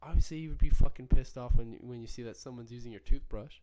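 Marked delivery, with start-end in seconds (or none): none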